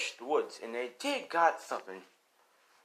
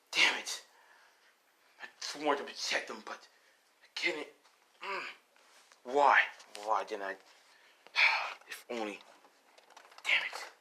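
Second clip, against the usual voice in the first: self-reproaching grandpa. Acted. Grandpa failed to protect him, remorseful. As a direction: mutter regret to yourself between pauses. Grandpa blames himself, squeezing hand.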